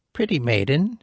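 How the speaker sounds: a hint of an old crone; lightly voiced old woman. Old woman to the princess by the brook, lightly voiced. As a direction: greet her kindly. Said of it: "she said".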